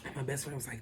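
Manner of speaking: usual speaking voice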